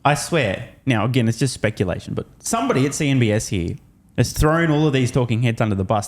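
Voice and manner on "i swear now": ordinary talk, nothing marked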